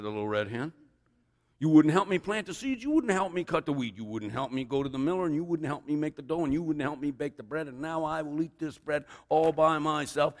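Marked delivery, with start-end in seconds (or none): 1.6-10.3 higher, insistent list of grievances; lightly voiced indignant hen; scolding storybook hen. A narrator's reading. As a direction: reel off the complaints as the hen.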